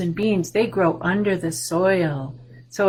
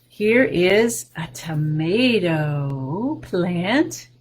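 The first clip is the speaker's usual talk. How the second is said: ordinary delivery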